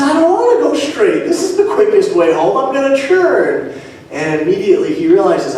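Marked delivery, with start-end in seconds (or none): 0.0-3.7 high-pitched acted objection; defiant protest. Driver argues with the small voice, high mock protest. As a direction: argue back, pitch leaping up. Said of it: "He says".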